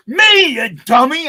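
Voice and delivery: put-on high, loud exasperated snap at the kid; exasperated star. [name] scolding the young assistant, loud and exasperated. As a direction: bark it like a star insulted that he's not recognised. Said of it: "And he goes".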